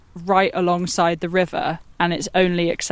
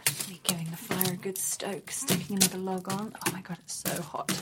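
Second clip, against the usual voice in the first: soft whisper by the fire; hushed, breathy whisper; 14 dB quieter than usual. Real, not acted.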